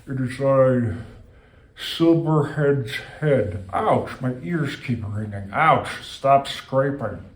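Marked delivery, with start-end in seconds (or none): 0.0-3.7 slow grand name. Pretend. Floating head names itself.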